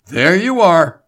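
high, loud burst of triumph; eager exclamation jumping up loud; excited cry. A narrator's reading. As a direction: cry it out, thrilled with the find. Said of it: "he cried excitedly".

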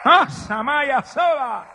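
high, drawn-out shout in tongues; real Pentecostal outburst; ecstatic cry. Real, not acted. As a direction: burst out loud and slow in fervor. Ecstatic outburst in tongues while preaching.

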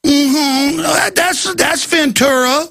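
high, sing-song mocking; high teasing coo; mocking tease. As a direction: tease him playfully. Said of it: "We're going".

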